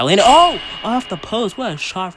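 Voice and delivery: loud, high gasp as it rings off the post; excited near-miss call. Acted. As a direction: ring out the shot like a hyped announcer. Near-goal call, hits post.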